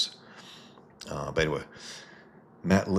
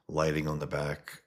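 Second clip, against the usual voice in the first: ordinary talk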